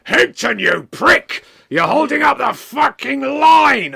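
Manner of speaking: high, loud, slow bellow; mock wrestler yell. McMania at the station barrier, bellowing at Higton. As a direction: roar it like a furious wrestler.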